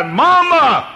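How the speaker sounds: very high, loud anguished cry; soldier's cry for mother. Retold or imitated. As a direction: wail it across the sea like a dying boy. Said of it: "because they heard that cry".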